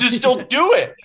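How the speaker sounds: high, loud exasperated blurt; mock exasperation. Host mocks a contestant's choice, high and loud. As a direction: throw it out like it's obvious. Mocking Clayton's decision.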